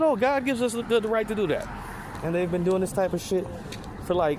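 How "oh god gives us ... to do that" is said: much higher, preachy mock-righteous voice; hammed-up holier-than-thou claim; mock pious colonizer. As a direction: sneer as a self-justifying zealot. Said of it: "they said".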